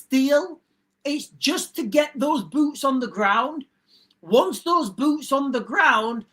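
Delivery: slow conspiratorial. Real, not acted. Conspiratorial warning about peace deal.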